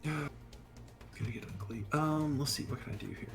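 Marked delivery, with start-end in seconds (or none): none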